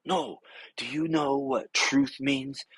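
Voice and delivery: slow, put-on voice of the lecturing friend; mock lecturing friend. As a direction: play him as a smug lecturer.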